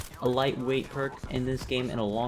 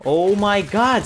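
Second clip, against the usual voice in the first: loud, high, drawn-out outburst; real gamer frustration; exasperated shout; 6 semitones higher, 9 dB louder, slower than usual. Real, not acted. Killed again in the game.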